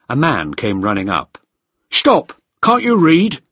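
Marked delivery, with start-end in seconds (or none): 1.9-3.4 high, loud challenge; shouting. A narrator's reading. Guard stopping a stranger on the island, shouting. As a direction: shout it sharply to halt an intruder. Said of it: "he shouted".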